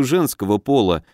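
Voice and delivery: plain reading voice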